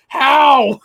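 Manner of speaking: high, loud disbelieving yelp; stunned at how much he eats; incredulous how. Retold or imitated. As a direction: blurt it out in shock. Disbelief at coworker's appetite.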